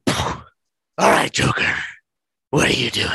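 loud gruff hero challenge; Batman voice. Acted. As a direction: bark it at the Joker like Batman. Batman voice confronts Joker.

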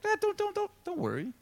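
high, soft stammered reassurance; light, high "calm down" voice; soothing interruption. Retold or imitated. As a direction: gently hush a panicking king. Prophet soothes the upset king.

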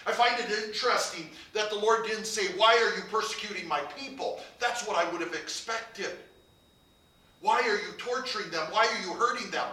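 loud, rising sermon build, slowing on the key words; full-force preaching climax; fervent preaching. Acted. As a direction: drive it home from the pulpit.

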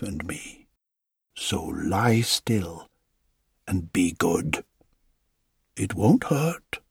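calm the wood before carving; old carver reassuring a block of wood, low and slow; soothing woodcarver. Acted.

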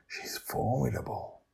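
hushed impressed whisper. Acted. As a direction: whisper it back. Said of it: "whispering back".

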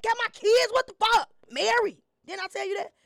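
high, loud irritated yelling; mock angry phone pickup. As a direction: snap at the caller, fake confused. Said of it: "I motherfucking answer the phone like".

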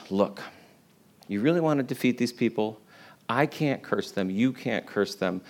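hushed schemer's advice; conspiratorial hush. Retold or imitated. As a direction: lean in and share a dirty secret. Secret scheming advice to the king.

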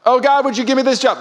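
high, loud whiny petition; mock sock prayer. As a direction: parody a small-minded prayer. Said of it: "that's a sock prayer".

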